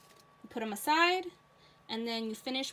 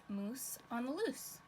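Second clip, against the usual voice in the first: put-on lilting storybook read-aloud for kids; reading to children; 4 dB quieter than usual. A narrator's reading. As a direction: read it like story time for little ones. Teacher reads picture book aloud.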